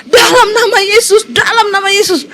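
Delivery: high cry that breaks near tears; husband crying out the name of Jesus. Retold or imitated. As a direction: call out the name in desperate relief. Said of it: "suaminya teriak".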